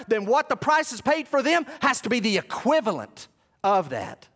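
loud, high-pitched pulpit proclamation; impassioned preaching. Acted. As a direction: preach at full force, drawing out key words. Preaches at full force.

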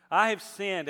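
booming motivational speech. Acted. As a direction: proclaim it with full force. Second take, louder and higher.

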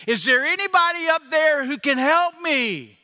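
high, pleading cry to the heavens; desperate man calling up. Retold or imitated. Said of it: "he says".

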